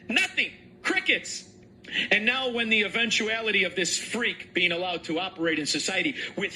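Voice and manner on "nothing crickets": mocking high nothing, crickets; sneering punchline; sarcastic. Real, not acted. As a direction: scoff at the silence. Mocking the silence.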